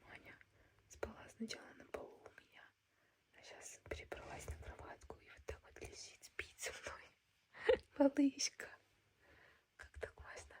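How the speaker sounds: hushed late-night whisper. Real, not acted. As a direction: whisper so nobody wakes. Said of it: "У меня джетлаг, я не могу заснуть".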